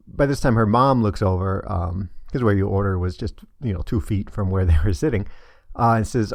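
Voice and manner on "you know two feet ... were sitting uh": ordinary talk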